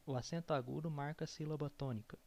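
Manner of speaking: rattled off quickly in one breath; fast repeat. Shown as a demonstration. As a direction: rush the sentence at speed.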